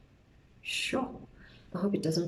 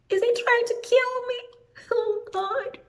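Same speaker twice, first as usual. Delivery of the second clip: much higher, panicky squeak; panicked. Retold or imitated. Recalling her thought under the knife, high panicked squeak. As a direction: let the fear jump into a high squeak.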